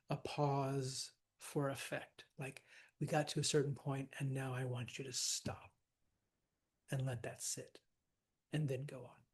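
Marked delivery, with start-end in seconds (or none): 3.0-9.2 soft, measured, leaving room between phrases; hushed director's instruction. Pretend. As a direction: let it settle quietly, with deliberate pauses. Voicing Shakespeare directing a pause.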